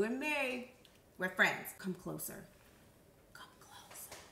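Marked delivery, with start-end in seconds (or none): 1.8-2.4 soft, low beckoning; hushed, lower invitation; confiding. Pretend. Draws viewer in for secret. 3.3-4.2 barely audible whisper; very soft conspiratorial beckoning; secretive whisper. Pretend. Repeats closer for a secret.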